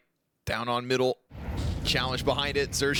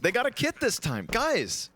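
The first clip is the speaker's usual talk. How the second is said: higher-pitched, eager call to everyone; excited announcement; 7 semitones higher than usual. Pretend. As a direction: announce it like big news. Announces the kit to all.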